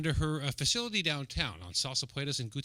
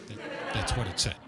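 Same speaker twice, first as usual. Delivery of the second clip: ordinary delivery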